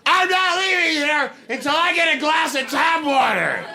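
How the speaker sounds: unhinged full-throated customer scream. Retold or imitated. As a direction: scream it like a customer turned lunatic. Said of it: "I am screaming".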